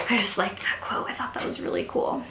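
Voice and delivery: a secret little aside; whispered aside. Real, not acted. Vlogger confiding to her viewers about a favourite quote, whispered. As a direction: whisper it to yourself, unvoiced.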